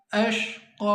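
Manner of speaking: अशक़ा with the vowel drawn out a little; lengthened vowel. Shown as a demonstration. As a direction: stretch the first vowel of अशक़ा slightly. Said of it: "थोड़ा सा लंबा पढ़ना".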